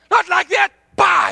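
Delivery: loud, high acted-out rebuke; imitated angry shout. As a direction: bellow it like an exasperated team captain. Acting out the captain's angry correction.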